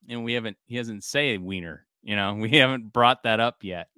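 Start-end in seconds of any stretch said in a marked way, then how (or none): none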